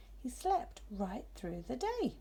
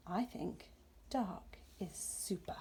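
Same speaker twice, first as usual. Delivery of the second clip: slow hushed verdict; 2 semitones lower, 9 dB quieter, slower than usual. Acted. Owlet's final delighted verdict.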